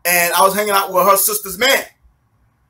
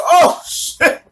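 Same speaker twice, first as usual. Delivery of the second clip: high-pitched startled yelp of words; startled alarm; 9 semitones higher than usual. Retold or imitated. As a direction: react as if your legs just gave way. Acts alarm as knees buckle.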